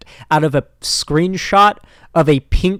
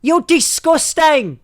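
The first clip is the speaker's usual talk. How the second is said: high, slow screeching Scottish impression; hammed-up Scottish shriek, very high; mock Scottish accent; 14 semitones higher, 7 dB louder, much slower than usual. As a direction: stretch it out in an overdone Scottish screech. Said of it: "she sounded like the".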